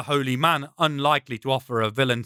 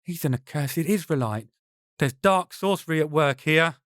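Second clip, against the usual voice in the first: usual speaking voice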